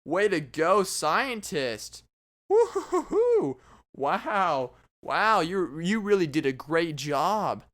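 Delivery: drawn-out mock admiration; hammed-up applause for a useless feat; sarcastic cheering.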